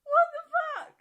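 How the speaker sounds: high, loud outraged cry; shocked shout. Real, not acted. Hearing the two-week deadline, a high shocked shout. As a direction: shriek it in disbelief.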